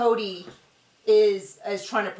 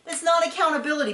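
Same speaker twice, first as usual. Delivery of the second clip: much higher, rising into a frustrated "No"; exasperated protest; 7 semitones higher than usual. Retold or imitated. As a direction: cut in, fed up and incredulous. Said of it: "You go".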